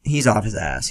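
usual speaking voice

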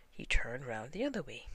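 usual speaking voice

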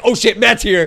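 urgent retold warning; retold alarm. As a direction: sound the alarm, half amused. Retold alarm at Matt arriving.

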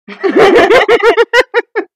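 loud, high belly laugh; burst of laughter. Real, not acted. Woman amused at being called preppy, loud high laugh. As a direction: crack up loudly. Laughs after "That's offensive.

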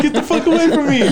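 laughing mock yell. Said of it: "He's like".

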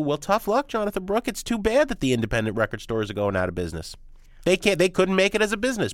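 smug big boss, lightly voiced; mock corporate voice. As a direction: brush it off like a heartless chain owner. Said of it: "I'm the big chain corporate retailer, and I say to you".

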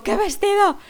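high, loud mock bafflement; startled question. Startled listener to the shouting woman, loud baffled question. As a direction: fire back a bewildered question.

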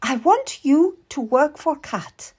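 high-pitched, lordly order to a servant; imperious. Acted. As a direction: command her as a grand lady would. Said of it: "Lady Willoughby said imperiously".

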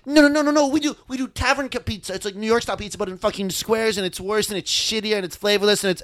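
high, whiny mock local. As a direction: mimic a smug Chicago local. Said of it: "And then they're like".

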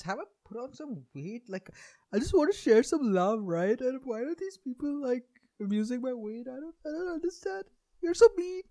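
high, whiny hurt voice; whiny mock poster. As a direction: play the wounded victim, whining. Mocking a thin self-conscious poster.

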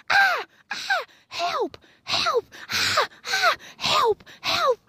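high-pitched hammed-up cries of help; mock cries for help. Imitating her mother crying for help in shallow water, high mock panic. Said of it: "I'm hearing".

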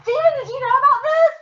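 very high, rushed squeal of disbelief; shocked demand. Real, not acted. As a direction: fire it off high and fast, astonished. Calls out to Steven in shock.

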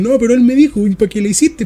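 protesting. Retold or imitated. Scolded kids blaming their classmate, mock protest. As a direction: retell a kid's indignant excuse.

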